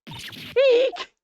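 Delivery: high squeal of fright; startled shriek. Acted.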